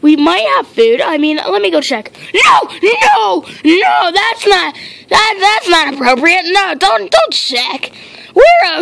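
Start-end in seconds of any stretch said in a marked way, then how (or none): 2.3-4.1 frantic shout. Acted. Frantic refusal.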